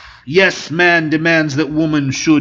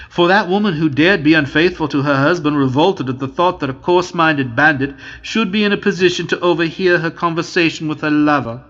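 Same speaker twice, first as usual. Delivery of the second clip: plain reading voice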